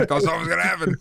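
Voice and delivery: high laugh breaking through the words. Real, not acted. Laughing at shared joke.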